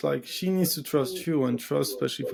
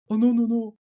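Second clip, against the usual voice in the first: much higher, louder squeal of mock alarm; mock shocked woman; 9 semitones higher, 4 dB louder than usual. Her shocked reply mocked.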